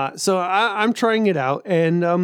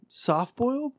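incredulous; 7 semitones higher, 5 dB quieter, slower than usual. Real, not acted. Incredulous echo of unknown term.

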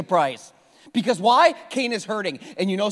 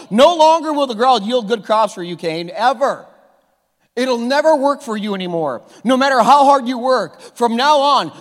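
a louder, booming voice of God passing sentence; God rebuking Cain; 3 semitones higher, 8 dB louder than usual. Retold or imitated. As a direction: pronounce the curse like a stern judge from above.

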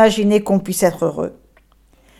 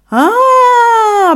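joyous cry; 12 semitones higher, 5 dB louder than usual. Acted. Donkey's joyous outburst.

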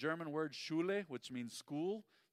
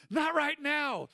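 high, loud sudden parent's snap; mock scolding shout; 12 semitones higher, 13 dB louder, slower than usual. Shown as a demonstration. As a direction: scold the kids to hush them, loud and high. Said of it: "That is what you call misdirected zeal".

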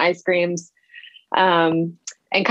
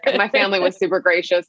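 normal talking voice

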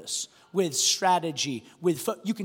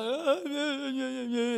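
soft mock-sobbing answer; lightly put-on crying voice; imitated tearful child; 5 semitones higher, 5 dB quieter than usual. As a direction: mimic a hurt kid whimpering through it. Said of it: "He's crying again".

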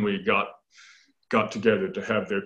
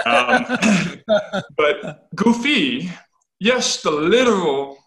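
higher, louder, slow and laughing; 8 semitones higher, 6 dB louder, slower than usual. Real, not acted. Teacher laughing at his goofy pun, slowed. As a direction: chuckle through the pun.

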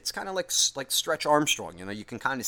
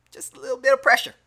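mock scolding voice; 7 dB louder than usual. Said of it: "I guess I could have thought about it more".

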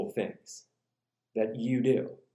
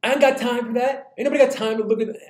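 high, loud and rushed, a harried complaint; frantic exasperation; 6 semitones higher, 4 dB louder, faster than usual. Acted. Preacher mimics a busy worrier, loud and frantic. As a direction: throw up your hands, no time for this.

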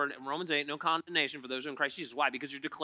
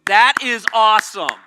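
imagined shout; 5 semitones higher, 16 dB louder than usual. Retold or imitated. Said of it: "had the boldness to go".

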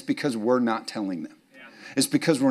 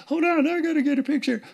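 very high, hurried picture-taker; mock eager relative. Pastor mimics relatives rushing to take photos. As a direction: squeal it like a frantic relative.

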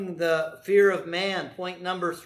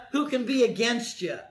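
ordinary talk

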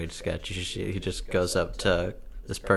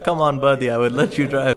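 louder, higher Persian car dealer; friendly Persian salesman accent; 6 semitones higher, 9 dB louder than usual. Retold or imitated. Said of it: "Typical Persian guy's like".

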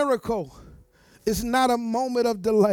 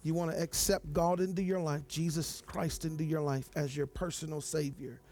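soft, low prayer voice; 5 semitones lower, 6 dB quieter than usual. Acted. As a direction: pray gently and invite them forward. Soft prayer and altar call.

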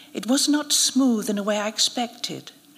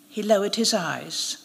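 ordinary delivery, nothing marked

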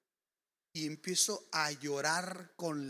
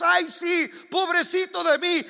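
higher, whiny mock self-pity; 10 semitones higher, 10 dB louder than usual. Preacher mocking the self-pitying believer, high and whiny. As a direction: parody a whiny victim. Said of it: "Que digamos".

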